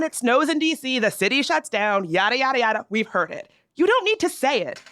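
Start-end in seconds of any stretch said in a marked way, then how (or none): 3.8-4.8 high, pointed you don't need to say it; exasperated. Acted. Host to Midwest transplants, higher, fed up. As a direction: stop them mid-boast, fed up.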